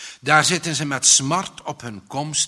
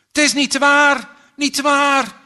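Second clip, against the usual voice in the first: hoog, luid krijsend van angst; gillen van schrik; 12 semitones higher, 10 dB louder than usual. A narrator's reading. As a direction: gil het uit in paniek. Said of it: "kan hij wel gillen, krijsen van bange schrik". (krijsend van bange schrik)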